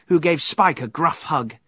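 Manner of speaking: ordinary delivery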